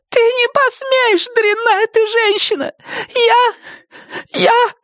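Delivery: very high, crying yell; tearful shout. Acted. Nettie in tears shouting at Wilma, crying yell. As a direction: cry it out in helpless rage.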